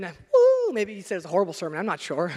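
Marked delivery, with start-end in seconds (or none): 0.0-0.7 high, loud whoop; mock cheer. Churchgoer hyped after the sermon, whooping loudly.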